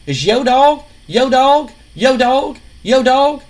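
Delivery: high, loud sneering mimicry of yo dog; mock his catchphrase over and over. King Lawler ridicules Scotty's catchphrase, loud and sneering. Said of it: "Jerry the King Lawler is just like".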